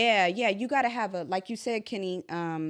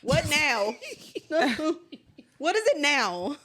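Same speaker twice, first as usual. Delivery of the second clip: put-on annoyed boyfriend; 6 semitones higher, slower than usual. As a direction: whine it like a fed-up boyfriend. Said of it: "That's pretty good".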